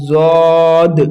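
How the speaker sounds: জদ long and heavy, full-mouthed; thick heavy sound. Shown as a demonstration. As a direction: stretch জদ in a thick voice. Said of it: "মোটা আওয়াজে আমাদেরকে বের করতে হবে". (মোটা আওয়াজে টেনে জদ)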